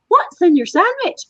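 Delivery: high, sing-song heckler demands; chat commenters' demands. Retold or imitated. Retelling how viewers took over the chat box, high mocking demands.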